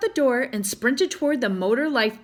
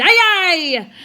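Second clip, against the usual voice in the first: loud, higher crew shout; united shout; 7 semitones higher, 9 dB louder than usual. A narrator's reading. The crew agreeing to make the pass, a loud shout. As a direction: shout it together. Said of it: "the crew shouted with a united".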